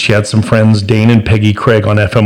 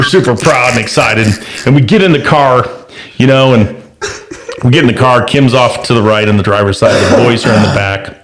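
ordinary delivery, nothing marked

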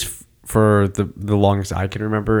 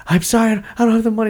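high, rushed, panicked plea; acted-out panicky excuse; panicking; 12 semitones higher, faster than usual. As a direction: plead fast and high for mercy. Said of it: "you see this guy who's panicking".

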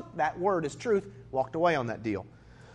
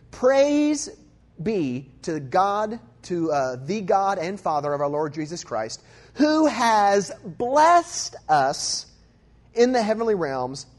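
loud, drawn-out praise, stretching each phrase; emphatic scripture reading; 3 semitones higher, 6 dB louder, much slower than usual. Acted. Preacher reading Ephesians aloud to the congregation, slow and loud. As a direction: proclaim it slowly like a preacher.

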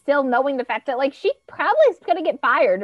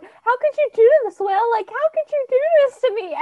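high, loud imitation of an outraged wife; mock outraged; 13 semitones higher, 4 dB louder than usual. Imitating Jessa accusing Will of betrayal. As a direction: play her betrayal big and high. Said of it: "Jessa is pissed".

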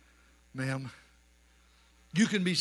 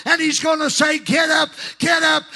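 high, loud, rhythmic revival shouting; shouted preaching climax; 10 semitones higher, 6 dB louder than usual. Real, not acted. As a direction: call the dead out with repeated shouted commands. Climax of the sermon, shouted commands.

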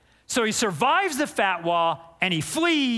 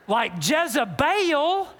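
Jezebel drawled Southern and slow; Southern accent; 5 semitones higher, slower than usual. Shown as a demonstration. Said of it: "with a deeply Southern accent".

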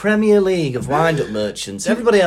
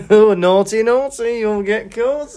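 high, louder teasing sing-song; sneaky tease; 7 semitones higher, 6 dB louder than usual. Pretend. Taunting someone filling up petrol, high sing-song. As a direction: pounce with a gleeful taunt. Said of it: "Just sneak in".

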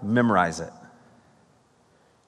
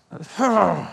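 anguished sigh; 7 semitones higher than usual. Real, not acted. After crying out at the verse.

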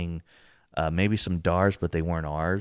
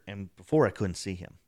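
ordinary talk, nothing marked

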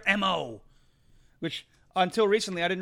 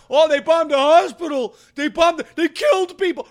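whiny mock wail; 7 semitones higher, 9 dB louder than usual. Mocking claims about the hospital.

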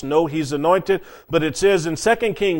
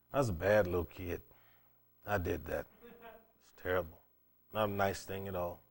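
low, soft, rueful aside; hushed confession; 9 semitones lower, 16 dB quieter than usual. Real, not acted. Preacher admitting he teased kids at school, hushed confession. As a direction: drop right down and own up to it quietly. Confessing his own childhood teasing.

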